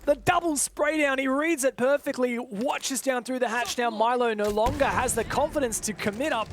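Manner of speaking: high, loud caster commentary; live play-by-play. Real, not acted. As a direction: ride the action as it happens.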